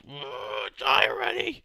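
real furious yell; shout. Real, not acted. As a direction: yell it at the zombie. Fighting a zombie.

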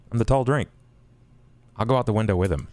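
usual speaking voice

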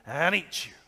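ordinary talk, nothing marked

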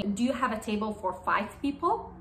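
ordinary delivery

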